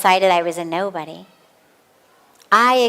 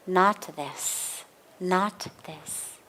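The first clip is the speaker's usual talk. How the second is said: quiet inner voice; 3 semitones lower, 10 dB quieter, much slower than usual. Shown as a demonstration. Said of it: "you come back and you say".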